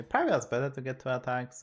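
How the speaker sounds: ordinary delivery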